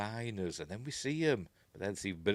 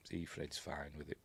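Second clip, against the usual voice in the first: usual speaking voice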